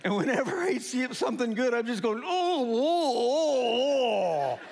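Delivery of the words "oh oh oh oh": long, high moans of delight; exaggerated food moan. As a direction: moan hammily over a delicious bite. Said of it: "I'm moaning and everything like this".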